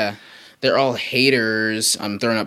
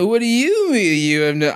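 mocking echo; 10 semitones higher, 3 dB louder than usual. Echoing partner to stall.

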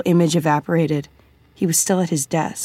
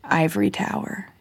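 softly trying out the words; hushed, experimental murmur; whispered; 3 semitones higher, 5 dB quieter than usual. A narrator's reading. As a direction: say it under your breath, testing the vowels. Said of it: "Vivi whispered".

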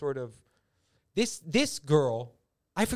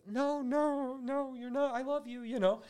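tender father; 10 semitones higher, slower than usual. Retold or imitated. Father comforting his daughter, high and tender. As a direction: soothe her gently, pitched high. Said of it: "he goes like".

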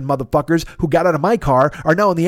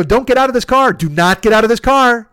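raised, insistent retold order; louder and higher, punching each word of the command; emphatic warning; 4 semitones higher, 4 dB louder than usual. Driver telling passengers to stay in the car, emphatic warning.

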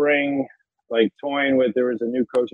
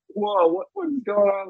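shocked; 12 semitones higher than usual. Retold or imitated. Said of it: "I was like".

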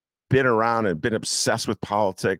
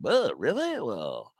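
mock haughty donor; 8 semitones higher than usual. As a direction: play a donor making you beg. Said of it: "some people are like".

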